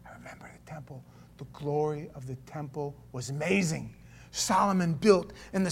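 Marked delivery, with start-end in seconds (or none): 0.1-3.9 awed hushed whisper. Real, not acted. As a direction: breathe it out in awe. Awed recollection, unvoiced.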